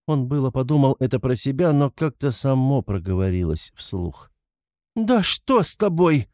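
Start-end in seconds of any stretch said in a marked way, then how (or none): 4.9-6.3 high, slow alarmed cry. A narrator's reading. Mother to her strangely behaving son, crying out. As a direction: cry out in distress, pitch leaping up. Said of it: "вскрикнула мать".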